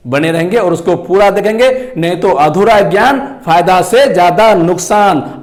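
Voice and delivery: ordinary delivery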